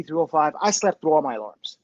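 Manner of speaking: ordinary delivery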